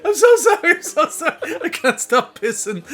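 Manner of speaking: high, loud imitation of cracking up; mock-hysterical, laughing retold apology; helpless laughing apology. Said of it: "When the guy beside him couldn't help".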